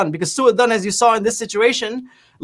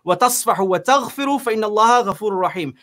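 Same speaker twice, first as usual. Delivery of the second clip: Quranic recitation; much faster than usual. Shown as a demonstration. Preacher quoting the Quran to his listeners, rapid recitation. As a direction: chant the verse in quick Arabic recitation. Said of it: "because Allah says".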